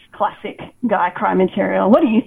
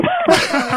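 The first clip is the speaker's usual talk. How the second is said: high burst of laughter; amused high-pitched laugh. Real, not acted. Laughs mid-sentence.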